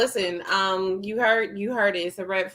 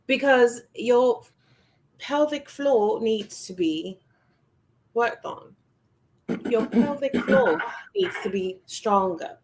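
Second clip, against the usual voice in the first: put-on English accent. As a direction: play a mock English health advisor. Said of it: "gotta say this in my English accent".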